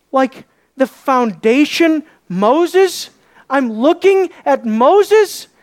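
slow, high rising questions; incredulous awe. Acted. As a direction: draw it out in astonished disbelief. Acts awe at seeing Moses.

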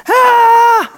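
high loud mock yell; startled yell. Disciple shouting in the sleeping Jesus' ear, loud.

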